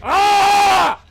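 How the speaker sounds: a short raw scream of frustration; sudden angry outburst scream; rage scream. Real, not acted. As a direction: let out a furious yell at the game. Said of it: "Yes, that is rage".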